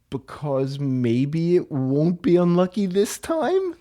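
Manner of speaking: usual speaking voice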